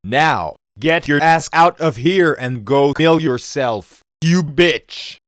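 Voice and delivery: loud, nasty yell. Acted. Bird yelling insults at Fiji. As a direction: yell abuse at her. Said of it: "we're going to yell at Fiji".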